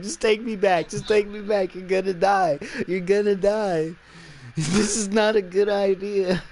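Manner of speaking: high, loud mock scream; screaming plea. Ass Face begging the cop to turn back, retold scream. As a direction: imitate a frantic captive screaming to be released. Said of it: "he literally screams".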